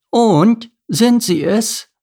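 high, loud, stretched-out question; insistent elderly lady, drawn-out and high; probing old lady. A narrator's reading. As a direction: press him for an answer, slow and high. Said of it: "hakte die ältere Dame nach".

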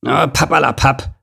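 mild mock growl of the rhino; lightly growled pooh-pooh; growled dismissal. A narrator's reading. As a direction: wave it off with a grumble. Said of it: "knurrte Lord Nase".

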